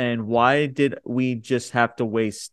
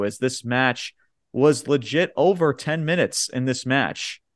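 ordinary delivery

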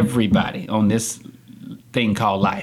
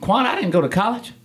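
higher-pitched retold confession; confiding admission; 11 semitones higher than usual. Man admitting to Daquan he skipped college, confiding. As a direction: own up to it, voice lifted. Retold quote of successful friend.

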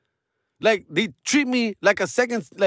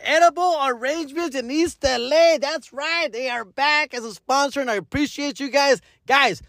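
upbeat higher sponsor pitch voice; cheerful higher promo read for a local store; 4 semitones higher than usual. Acted. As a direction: sell the fruit shop like a hype ad.

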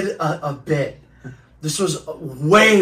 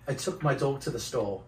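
lower, soft British accent attempt; 6 semitones lower, 15 dB quieter than usual. Shown as a demonstration. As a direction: quietly try out a British accent. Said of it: "Say something in a British accent".

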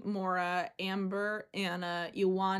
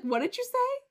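stunned disbelief. Retold or imitated. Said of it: "And she's like".

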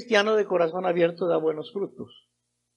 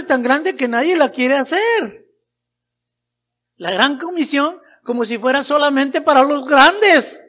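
raised, emphatic pulpit voice; full-force preaching; 7 semitones higher, 9 dB louder than usual. Real, not acted. Preacher challenging excuses about the great commission, full-force preaching. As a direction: challenge the congregation loudly.

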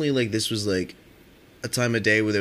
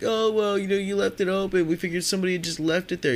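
higher, whiny voice for the thief making excuses; mock excuse voice; 9 semitones higher than usual. Beer thief excusing himself to the angry buddy in a higher mocking voice. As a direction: play the guy as a weaselly excuse-maker, pitched up.

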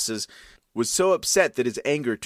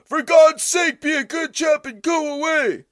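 much higher, louder indignant neighbor shouting; neighbors scolding; 5 dB louder than usual. Acted. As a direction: bawl him out from the window. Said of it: "started to bay at him".

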